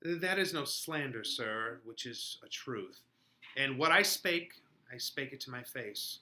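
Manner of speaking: reading the scene's parts with quiet intent; acted Shakespeare dialogue. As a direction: voice the lovers' exchange softly, in character. Reading Romeo and Juliet aloud.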